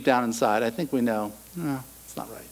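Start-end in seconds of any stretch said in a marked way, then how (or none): 1.5-2.4 much softer, a faint inner voice; still small voice. Pretend. Preacher voicing the quiet inner voice of conscience, faint. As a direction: let the conscience barely speak. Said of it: "that still small voice".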